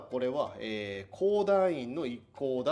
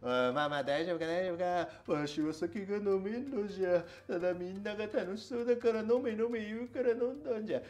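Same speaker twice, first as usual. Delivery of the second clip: put-on drunk old man; 7 semitones higher than usual. Drunk man carried by friends.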